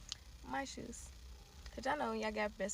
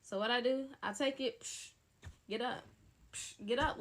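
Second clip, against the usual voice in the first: usual speaking voice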